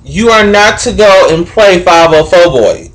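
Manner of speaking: ordinary talk, nothing marked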